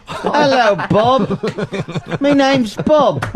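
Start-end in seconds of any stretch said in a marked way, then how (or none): 2.2-3.2 slow, high mock English accent; hacky English accent. Host pretending to be Bob in a mock English accent. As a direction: drawl it out in a silly English voice. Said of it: "our hacky English accent".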